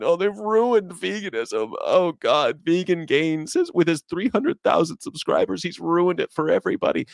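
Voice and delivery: high, whiny-style mock lament; mock complainer. Mimics complainers about veganism.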